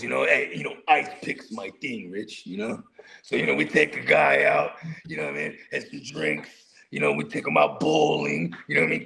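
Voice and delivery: mafia guy. Retold or imitated. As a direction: drop way low like an old mob boss telling war stories. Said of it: "they start telling mafia stories".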